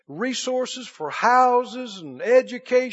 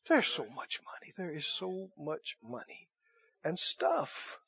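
much softer, lower, almost a breath; hushed preaching; 5 semitones lower, 15 dB quieter than usual. Acted. Preacher dropping to a hush before the congregation. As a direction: drop to a hush for emphasis. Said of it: "hushed after climax".

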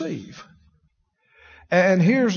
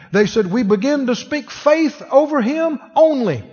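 fervent preaching; 5 semitones higher than usual. Acted. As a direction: preach it with rising conviction. Preaching at full force.

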